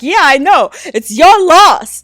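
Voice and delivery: mock sulky brush-off. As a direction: imitate a hurt man pretending not to care. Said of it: "he was like".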